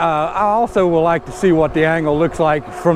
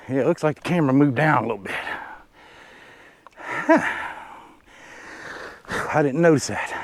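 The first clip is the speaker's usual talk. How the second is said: ordinary talk, nothing marked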